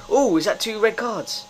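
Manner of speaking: high, loud excited question; real excited double-take; gleeful surprise. Real, not acted. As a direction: pipe up in delighted disbelief. Reacts to opponents' red cards.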